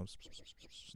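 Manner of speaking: hissed psst-psst whisper noises; wordless, very soft sibilant whispers; imitated whispering. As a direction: mimic someone whispering in an ear. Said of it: "whispering in her ear".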